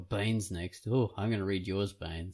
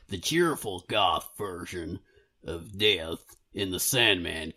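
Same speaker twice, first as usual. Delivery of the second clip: put-on American accent. Co-host reading Baines's letter as an American, shaky put-on accent. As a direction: read it as a Brit doing a hammed-up American. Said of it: "As an American".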